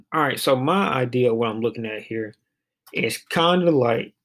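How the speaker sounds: usual speaking voice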